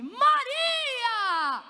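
high, long wailed shout; hammed-up cry of greeting; answering cry. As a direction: call the name back, stretched and falling. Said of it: "Isabel responde".

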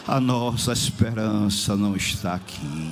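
low, grave declaration; solemn exhortation. Real, not acted. Preacher reminds the church where hope lies, low and solemn. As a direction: drop low and intone it solemnly.

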